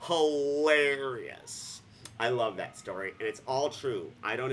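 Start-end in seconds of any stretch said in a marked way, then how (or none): 0.1-1.8 very slow, stretched word; a slow, drawn-out verdict. Real, not acted. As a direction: drag it out slowly. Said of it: "slow drawn-out verdict".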